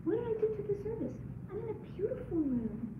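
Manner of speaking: high and soft, voice catching with tears; humbled amazement at your blessings; grateful wonder. Shown as a demonstration. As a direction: ask it in awe at all you've been given. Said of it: "What about?".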